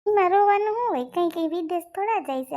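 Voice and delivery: loud, high-pitched objection; scolding protest. Acted. Telling the family there is nothing to cry about, scolding protest.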